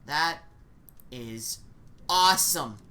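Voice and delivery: ordinary delivery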